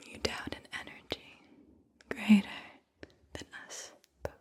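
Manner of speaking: slow whispered verse with long pauses between phrases; whispered poem reading. Acted. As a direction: whisper the poem phrase by phrase, letting each one settle. Reads a poem aloud.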